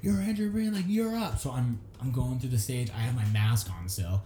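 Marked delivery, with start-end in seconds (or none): 0.0-1.4 high-pitched imitation of a manager; retold urgent summons, pitched up. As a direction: hurry someone on in a raised, high voice. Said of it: "his manager runs out and grabs me".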